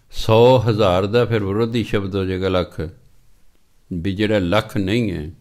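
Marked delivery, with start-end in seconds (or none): none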